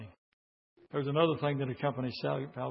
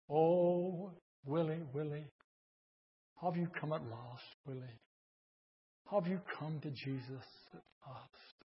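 much softer, tender call to a son; mother calling softly; 8 dB quieter than usual. Retold or imitated. Mother finds her son praying at dawn, soft and tender. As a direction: call him home gently, almost breathless. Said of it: "she called out to his name and said".

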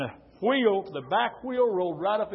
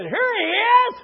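high loud gotcha shout; triumphant find; 14 dB louder, slower than usual. Retold or imitated. Older brother pulling back the covers, triumphant shout. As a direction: crow it like you caught him. Brother finding boy in bed.

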